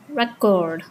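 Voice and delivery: "record" as a verb, re-CORD; in "record", lean on the final CORD; second-syllable stress. Shown as a demonstration. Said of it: "stress falls on the second syllable".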